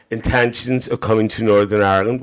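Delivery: usual speaking voice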